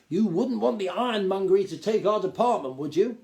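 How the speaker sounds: high, loud, self-important Duke lecturing; a pompous gnome lord talking down to the boy. Acted. Duke's pompous speech.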